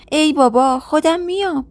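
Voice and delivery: a mild cry of protest; exasperated protest. Acted. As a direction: insist you can walk on your own, rising exasperation. Protesting while dragged.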